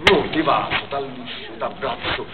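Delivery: ordinary talk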